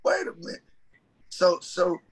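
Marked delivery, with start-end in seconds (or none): 0.0-0.6 high-pitched wait a minute; astonished. Retold or imitated. Preacher stunned at catching fish, high and astonished. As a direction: stop yourself, stunned by the catch.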